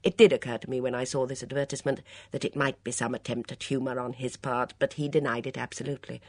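ordinary delivery

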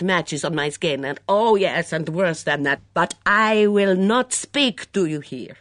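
put-on Mitzi, hunted and defiant, in a heavy foreign accent; a suspicious foreign cook in a thick accent, rising to outbursts. Mitzi voiced by narrator.

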